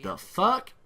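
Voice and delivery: "The fuck?" thrown up high with a rising tune; put-on voice, mock outraged "The fuck?"; incredulous exclaim. His men not shooting back, a high incredulous "The fuck?". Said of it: "I was like".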